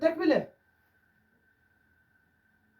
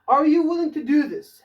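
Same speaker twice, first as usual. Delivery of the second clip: very slow, higher and louder, each word clear; slow raised teacher example; slow dictation; 7 semitones higher, 8 dB louder, much slower than usual. Shown as a demonstration. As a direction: read the question slowly for learners. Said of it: "teacher reads example question".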